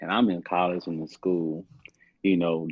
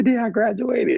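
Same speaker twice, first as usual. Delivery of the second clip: exasperated; 6 dB louder than usual. Retold or imitated. Exasperated retold reply.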